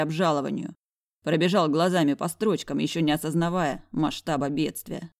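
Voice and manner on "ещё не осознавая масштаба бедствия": usual speaking voice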